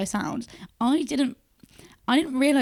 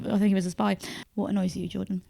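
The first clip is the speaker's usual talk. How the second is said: usual speaking voice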